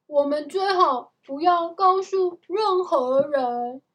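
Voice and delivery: loud, high, insistent advice; emphatic urging. A narrator's reading. Jack warns Annie to tell no one, emphatic urging. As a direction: urge it firmly and loudly.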